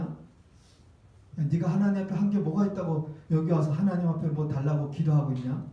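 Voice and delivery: low-pitched accusing devil; devil's accusing murmur. Acted. Devil accusing the pastor at prayer, low accusing voice. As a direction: needle the praying pastor in a low voice. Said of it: "마귀가 귀에다 대고 속삭여요".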